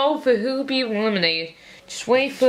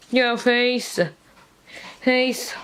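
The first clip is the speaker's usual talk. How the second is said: ordinary talk, nothing marked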